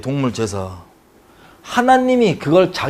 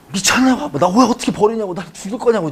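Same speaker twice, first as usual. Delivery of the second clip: higher, pleading mock shout of a terrified son; desperate cry; 6 semitones higher than usual. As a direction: cry out to father as if betrayed. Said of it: "막 소리쳤을 거예요".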